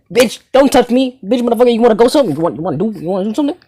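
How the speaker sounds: put-on imagined angry girlfriend. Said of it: "She's like".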